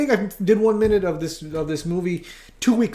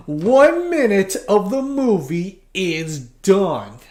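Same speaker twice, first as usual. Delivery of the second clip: slow announcement; 3 semitones higher, 5 dB louder, much slower than usual. Real, not acted.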